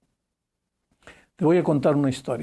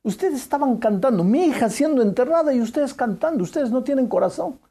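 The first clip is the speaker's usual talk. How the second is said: a grieving father snapping back, higher and rising in anger; a preacher acting out a bitter, upset father at the grave; aggressive retort; 7 semitones higher than usual. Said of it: "le dijo a la chica agresivamente".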